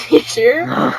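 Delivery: playful high teasing check. Real, not acted. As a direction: needle her, laughing. Teasing friend, laughing.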